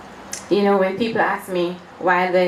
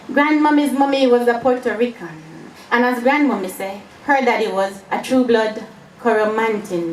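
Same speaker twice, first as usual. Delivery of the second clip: lilting, raised Jamaican storytelling verse; poetry recital; 4 semitones higher than usual. Acted. Poet recalls grandmommy's stories in verse, poetry recital. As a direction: recite with a rhythmic swing, quicker on the refrains.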